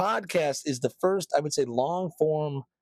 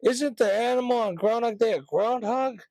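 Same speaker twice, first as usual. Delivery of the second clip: high, slushy mock customer; imitating Alexa user; 8 semitones higher than usual. Customer complaining to Alexa about the ferret, mock indignant voice. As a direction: whine it up high, comically indignant. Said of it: "people in the shop asking".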